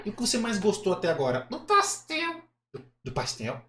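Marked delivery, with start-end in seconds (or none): 1.5-2.4 very high puppet voice; Kleber. Acted. Puppet telling host what he liked, high puppet voice. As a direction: answer as a high-pitched dummy. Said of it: "acha uma voz que pareça com o boneco".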